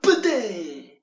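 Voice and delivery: loud high "ba-dang" impact noise; punch sound effect. Retold or imitated. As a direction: shout it like a cartoon punch. Imitated punch.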